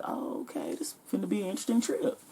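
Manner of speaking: hushed, fast muttered thought; whispered aside. Retold or imitated. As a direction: whisper it to yourself, half to the crowd. Inner reaction, I'm like.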